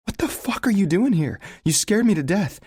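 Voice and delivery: high, loud shocked yelling; startled angry woman. A narrator's reading. Sarah finds Leo on her couch at night, shocked yelling. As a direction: yell at him, still shaken from the fright.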